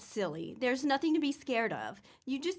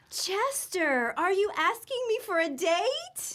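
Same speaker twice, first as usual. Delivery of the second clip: gasp of surprise; 7 semitones higher than usual. Acted. Peggy realizes Chester is asking her out.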